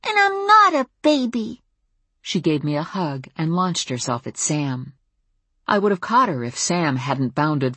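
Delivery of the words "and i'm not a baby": put-on very high small-child protest; high-pitched preschooler pout; indignant little girl. A narrator's reading. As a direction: object firmly as a tiny kid. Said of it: "repeated Maya".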